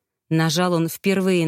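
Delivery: ordinary delivery, nothing marked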